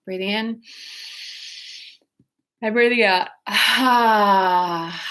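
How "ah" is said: long open ah on the out breath; releasing sigh; voiced sigh on ah. Shown as a demonstration. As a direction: let the breath out as a sound. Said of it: "we're making sound as well here".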